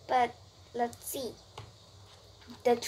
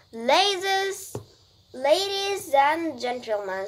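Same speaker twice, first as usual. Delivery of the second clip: higher, louder opening address; loudspeaker announcer; 5 semitones higher, 4 dB louder, slower than usual. A narrator's reading. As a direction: hail the crowd like an announcer. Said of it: "said the loudspeaker".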